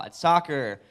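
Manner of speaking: ordinary talk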